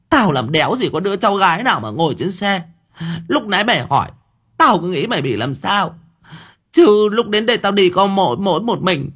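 high-pitched scolding outburst; snappish, irritated. A narrator's reading. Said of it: "Bà chủ nhà nói như gắt".

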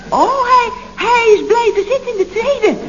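veel hoger, verbaasd uitgeroepen; gespeelde verbazing. Acted. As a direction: reageer geschrokken op het nieuws. Verbaasde reactie aan telefoon. (hoge, verbaasde stem)

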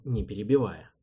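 plain reading voice

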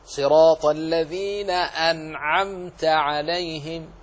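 slow chanted recitation, clean vowelless N in "أنعمت"; clear still nun. Shown as a demonstration. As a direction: recite slowly with "أنعمت" as an-'amta. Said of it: "كذلك النون في أنعمت". (ترتيل مع بيان سكون النون في "أنعمت")

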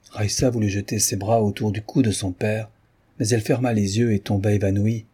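usual speaking voice

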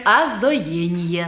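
«озоение» said with the r swallowed, slow and teasing; slow mocking imitation of a burred r. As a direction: drawl «озоение» dropping each r like a lisping child. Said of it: "а мне нравится картавить".

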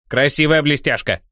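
squeaky greedy goblin; a small goblin gawking at shiny things. Acted. Goblin marvels at trinket.

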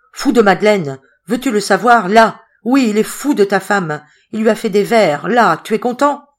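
loud, high, emphatic retort; exasperated outburst. A narrator's reading. Coriolis tells Patrice the truth, exasperated and loud. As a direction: hurl the answer at him, fed up.

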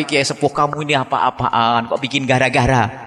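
ordinary talk, nothing marked

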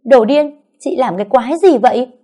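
high, loud outraged yell; angry loud shout. A narrator's reading. Mother yells at the woman who slapped Bông, angry shout. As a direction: burst out furious at the other mother. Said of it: "Mẹ Bông tức mình quát lớn".

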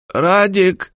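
high granny call from next room; from another room. A narrator's reading. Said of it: "раздался старушеский голос из соседней комнаты".

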